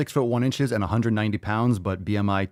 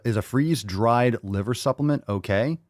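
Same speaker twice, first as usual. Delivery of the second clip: usual speaking voice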